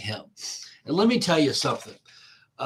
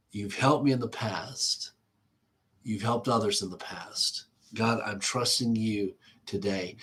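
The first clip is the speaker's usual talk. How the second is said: soft, lowered prayer of trust; hushed prayer; 4 semitones lower, 6 dB quieter than usual. Acted. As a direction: let the voice drop into a quiet prayer. David's trusting prayer, voiced.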